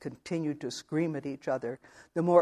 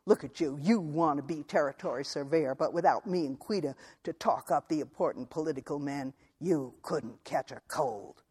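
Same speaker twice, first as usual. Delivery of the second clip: scornful anger. Acted. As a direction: tear him down with hoarse scorn. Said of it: "she said in a hoarse voice".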